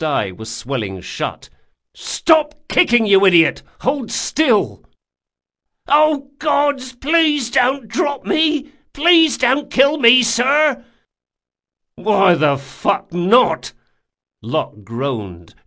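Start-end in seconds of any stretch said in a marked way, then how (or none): 1.9-4.8 high, loud furious shout; angry yell. A narrator's reading. Locke to the thrashing bandit, furious yell. 5.9-10.8 high, pleading, slow panicked whine; terrified begging. A narrator's reading. Bandit begging Locke not to drop him, terrified plea. 11.9-13.7 slow, loud hostile retort; menacing snarl. A narrator's reading. Locke answering the begging bandit, menacing snarl.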